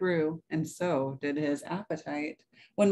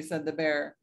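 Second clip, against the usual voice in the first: ordinary delivery, nothing marked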